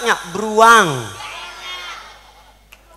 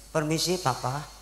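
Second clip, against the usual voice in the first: soft, lowered humble excuse-me; meek polite; 5 semitones lower, 12 dB quieter than usual. Retold or imitated. Wife passing her seated husband, soft meek permission. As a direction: ask leave very gently.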